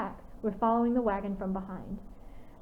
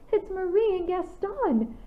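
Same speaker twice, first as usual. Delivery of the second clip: much higher, slow, thrilled cry; excited exclamation; 10 semitones higher, 3 dB louder, slower than usual. A narrator's reading. Clara seeing her packmates, excited exclamation. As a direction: burst out on spotting old friends. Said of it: "Clara exclaimed excitedly".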